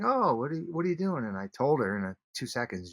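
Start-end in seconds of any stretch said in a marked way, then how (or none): 0.0-1.2 surprised stranger. Retold or imitated. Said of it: "She's like".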